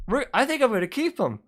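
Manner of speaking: casual Satan. Retold or imitated. Satan refusing God's request, lightly voiced breezy devil. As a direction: shrug it off like a cool devil. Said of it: "and Satan's like".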